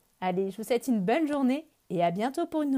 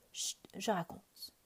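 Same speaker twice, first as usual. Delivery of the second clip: hushing softly; 9 dB quieter than usual. Acted. Narrator hushing the children.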